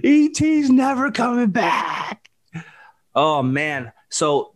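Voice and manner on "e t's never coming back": put-on high, slow childish lament; heartbroken kid. Kid crying to mom as E T leaves, high slow lament. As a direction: plead with mom like a crushed little boy. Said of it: "I'm like".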